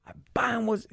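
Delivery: high, forceful declaration; commanding rebuke. Shown as a demonstration. Showing his friend how he rebuked her, forceful high command. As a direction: bind it with authority. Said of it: "like, with power and authority".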